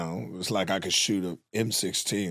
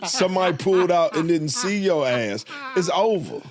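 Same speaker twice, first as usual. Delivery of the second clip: excited protest; 6 semitones higher than usual. Real, not acted. Heated defence of his fear.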